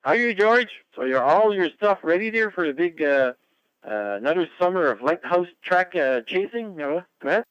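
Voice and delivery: bright, loud on-air greeting; net control announcer. Real, not acted. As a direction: hail the station like a radio host.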